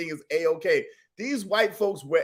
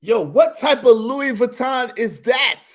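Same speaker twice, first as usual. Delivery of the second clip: louder, slow shouted question; incredulous shout; 4 semitones higher, 6 dB louder than usual. Real, not acted. Reactor shouts at the fake Louis Vuitton hat. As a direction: yell it in disbelief.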